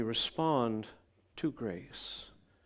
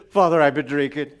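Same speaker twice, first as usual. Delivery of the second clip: put-on loud, earnest drunk confession; 2 semitones higher, 13 dB louder than usual. Drunk neighbour confesses to pastor, loud. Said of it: "he goes".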